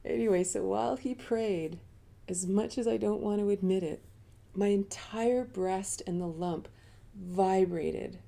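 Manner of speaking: normal talking voice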